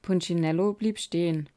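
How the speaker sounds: ordinary delivery, nothing marked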